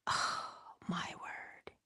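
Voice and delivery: awed whisper. Real, not acted. Remembering the great narrators, an awed whisper. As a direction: breathe it out in awe.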